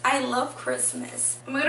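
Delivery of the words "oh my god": ordinary talk, nothing marked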